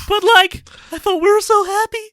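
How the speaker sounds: high squeaky cartoon heartbreak; Shaggy. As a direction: squeak out mock dismay at divorce. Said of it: "Shaggy's just discovered his".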